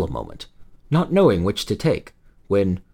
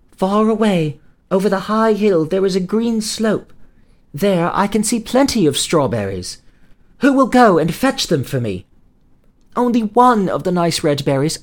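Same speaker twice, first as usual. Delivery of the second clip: put-on high, faraway feverish girl voice; dreamy sick girl; 9 semitones higher, 4 dB louder than usual. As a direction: dream it aloud like a sick child. Feverish girl describes her vision.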